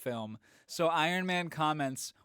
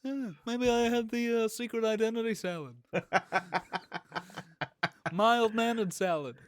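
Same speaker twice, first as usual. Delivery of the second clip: high, whiny stand-up bit; Jerry Seinfeld; 9 semitones higher than usual. Acted. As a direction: puzzle over it like an observational comic. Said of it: "that's a Jerry Seinfeld joke".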